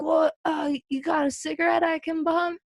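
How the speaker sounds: high, hesitant ask from a stranger; sheepish stranger. Retold or imitated. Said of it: "the guy's like".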